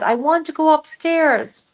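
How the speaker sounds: high, pleading dream-woman voice; mock tearful woman. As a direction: imitate a woman plaintively asking. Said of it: "she's crying and crying and crying".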